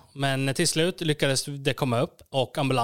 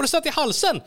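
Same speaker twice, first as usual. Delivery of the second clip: very high, louder alarmed cry; alarmed realisation; 12 semitones higher, 3 dB louder than usual. Retold or imitated. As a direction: realise in panic she is choking. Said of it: "Jag bara". (mycket hög, panikslagen utrop)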